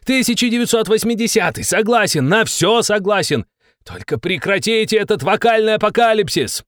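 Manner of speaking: roaring. Acted. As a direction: yell to make it stop. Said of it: "взревел я".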